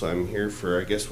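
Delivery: usual speaking voice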